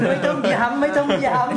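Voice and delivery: laughing, high and slow; giggling don't remind me; laughing protest. Real, not acted. Teased about age, laughing.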